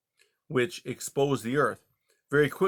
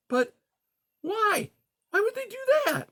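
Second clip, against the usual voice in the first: much higher, astonished fox voice; a small fox shocked. Acted. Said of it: "his voice full of astonishment".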